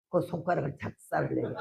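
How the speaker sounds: usual speaking voice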